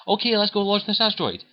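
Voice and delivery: urgent. Retold or imitated. Urgent reply to two-day countdown.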